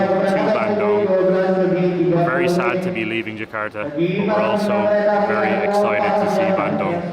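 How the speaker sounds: loud, slow, upbeat host voice; vlog host intro. Acted. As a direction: announce it to the camera with energy.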